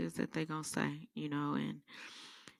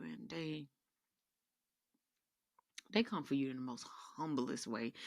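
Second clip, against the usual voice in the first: usual speaking voice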